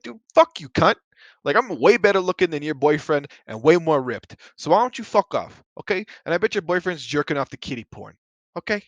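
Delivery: loud, high imagined comeback rant; mock furious rant. Imagined comeback yelled at the judgmental friend, loud and high. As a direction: blast her with a pretend comeback.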